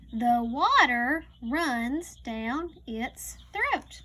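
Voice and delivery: slow, careful read-aloud; reading to a child. Acted. Teacher reading a farm book to a child, slow read-aloud. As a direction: read each word slowly for a young learner.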